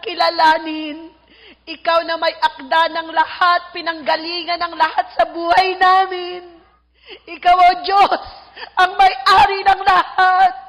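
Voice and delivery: impassioned prayer building to loud cries, then easing; fervent prayer. Real, not acted. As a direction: plead to God with swelling force. Leads congregation in prayer.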